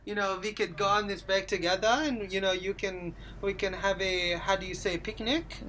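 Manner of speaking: soft, halting mock-Swedish accent; tentative amateur Swedish accent; attempted Swedish accent. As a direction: grope for words in fake Swedish-accented English. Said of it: "speaking English in a Swedish".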